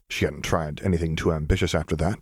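plain reading voice

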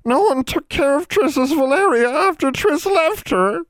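very high, slow, wailing elf voice; a tiny elf wailing in grief; wailing house elf. A narrator's reading. Old elf wailing to Valeria's family. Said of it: "Triss wailed".

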